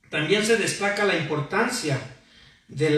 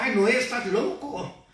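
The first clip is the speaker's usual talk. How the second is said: mock jeering call; 6 semitones higher than usual. People jeering at Noah over the ark, mock jeering. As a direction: imitate a scoffing crowd calling out. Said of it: "toda la gente le gritaba".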